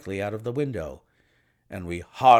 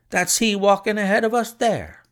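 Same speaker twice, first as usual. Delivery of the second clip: excited; 9 semitones higher than usual. A narrator's reading. Clerk spotting man.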